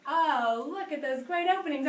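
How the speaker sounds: delighted praise. Real, not acted. Praising the class's open mouths, delighted.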